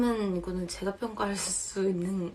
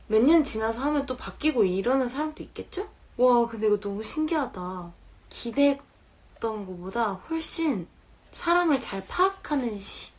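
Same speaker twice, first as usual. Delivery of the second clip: ordinary talk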